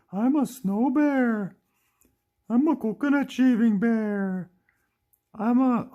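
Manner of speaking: high, loud, drawn-out toy-bear voice; Diva. Pretend. Toy bear says what kind of bear, high funny voice. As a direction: announce it high and stretched, as a silly bear. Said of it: "Diva goes like".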